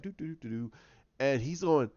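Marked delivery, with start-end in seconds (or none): 0.0-0.7 soft, quiet humming tune; carefree humming. Retold or imitated. Poe walks through the massacre unbothered, soft humming. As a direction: hum along without a care. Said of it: "Poe is walking through it like".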